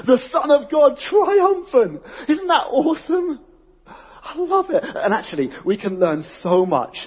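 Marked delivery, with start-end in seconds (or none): 0.1-4.8 soaring high, loud and slow on triumphant; triumphant exclamation. Real, not acted. Preacher celebrating Jesus beating temptation, ecstatic shout. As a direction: exult at the victory with a laugh.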